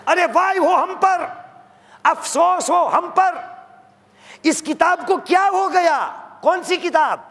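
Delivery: high, loud wail of dismay; anguished lament. Acted. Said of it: "वहाँ पर लोगों कहेंगे".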